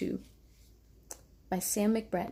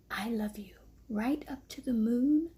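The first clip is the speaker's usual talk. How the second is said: whispered with a smile; 2 semitones higher than usual. Acted. Big hare whispering love to little hare at bedtime. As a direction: breathe it tenderly, almost silent at the end. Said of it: "whispered with a smile".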